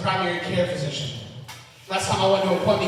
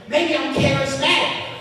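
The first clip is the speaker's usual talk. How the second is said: mock-indignant, higher and drawn out; indignant retort; 5 semitones higher than usual. Comic answering people who doubt him, indignant retort. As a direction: slowly start building a defensive rant. Acted retort to rude questioners.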